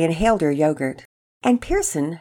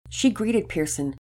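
plain reading voice

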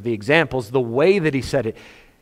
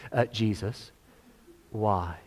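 soft sigh; 6 semitones lower, 11 dB quieter, slower than usual. Retold or imitated. Mary in quiet distress.

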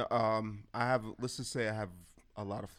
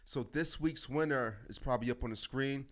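usual speaking voice

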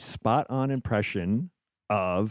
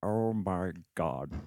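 very slow, lower, softer menacing villain drawl; Alan Rickman as Hans Gruber; 3 semitones lower, 6 dB quieter, much slower than usual. Shown as a demonstration. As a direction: draw it out like Hans Gruber savoring each word. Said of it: "Alan Rickman as Hans Gruber".

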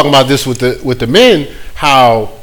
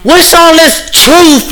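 mock outrage; 9 semitones higher than usual. Preacher mocking an offended churchgoer, high and indignant.

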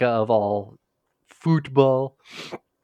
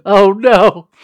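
louder dismayed wail; 8 semitones higher, 13 dB louder than usual. Retold or imitated. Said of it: "and I was like".

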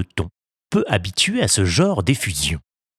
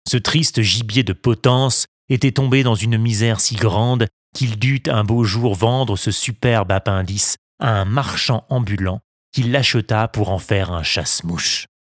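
ordinary delivery, nothing marked

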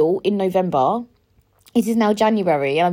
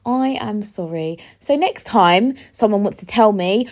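ordinary talk

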